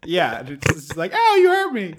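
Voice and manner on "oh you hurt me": high, hammed-up little cry of pain; high whiny mock protest of an injured clam; mock hurt clam. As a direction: squeak it like a tiny wounded clam. Clam burned by cigar ash.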